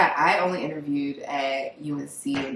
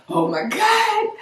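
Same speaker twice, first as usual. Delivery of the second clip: high, slow gasp of dread; student dreading a rejection email; slower than usual. Retold or imitated. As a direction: breathe it out in sinking dread. Heart dropped at email.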